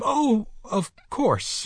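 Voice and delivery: high, drawn-out panicky cry; hysterical. A narrator's reading. Terrified nurse answers Mister Button, hysterically. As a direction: squeal it out in panic. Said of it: "she cried hysterically".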